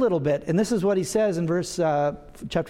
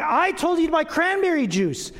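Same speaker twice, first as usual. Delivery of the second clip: mock exasperated wife; 9 semitones higher than usual. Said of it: "She says it in a much more loving voice".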